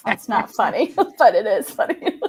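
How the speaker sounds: talking through real laughter; laughing. Real, not acted. Reacting to fire in every picture, amused laughing voice. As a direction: try to object but keep giggling.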